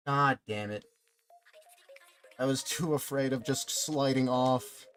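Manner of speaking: ordinary talk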